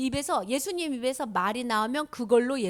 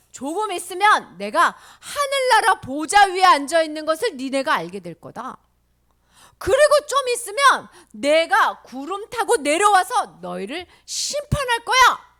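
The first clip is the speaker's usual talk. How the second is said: loud, slowing pronouncement of judgement; forceful proclamation; 5 semitones higher, 10 dB louder than usual. Acted. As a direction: proclaim it with ringing authority. Voices Jesus proclaiming coming judgement.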